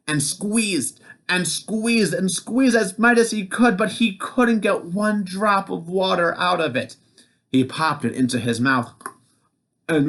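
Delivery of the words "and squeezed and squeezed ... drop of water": rising higher with strain; building squeeze. A narrator's reading. Narrator showing giant straining, rising pitch. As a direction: climb as he squeezes.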